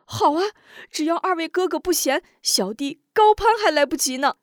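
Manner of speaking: put-on delighted young man; overjoyed. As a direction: gladly accept the brotherhood. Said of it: "满心欢喜".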